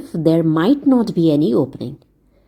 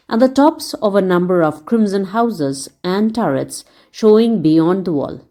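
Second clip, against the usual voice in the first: ordinary delivery, nothing marked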